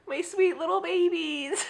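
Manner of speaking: high soft cooing. Real, not acted. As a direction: coo at them like at newborns. Cooing over tiny books.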